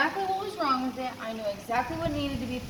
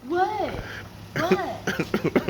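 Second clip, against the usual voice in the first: guilty dad; 6 semitones higher, 4 dB louder than usual. Retold or imitated. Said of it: "That's what my dad does when he's guilty".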